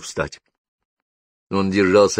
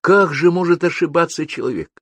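high-pitched astonished question; amazed; 10 semitones higher, 4 dB louder than usual. A narrator's reading. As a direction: marvel at it in a raised voice. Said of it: "и поразился".

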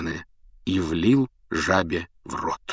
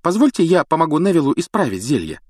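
eager schoolgirl; 9 semitones higher, 4 dB louder than usual. Acted. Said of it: "вмешалась Гермиона".

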